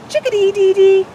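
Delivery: high, loud chickadee song; loud high chickadee imitation; chickadee call. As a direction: belt it out like a chickadee. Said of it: "Chickadee is an acrobat".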